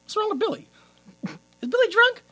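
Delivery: high, small mock-confused voice; puzzled bystanders, imitated. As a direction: ask it way up high, baffled. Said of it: "And everyone's like".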